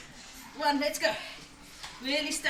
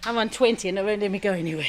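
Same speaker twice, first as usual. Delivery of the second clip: panting between words; out of breath from cycling; heavy breathing; 3 semitones lower, 5 dB louder than usual. Real, not acted. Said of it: "Sorry for the heavy breathing into the mic".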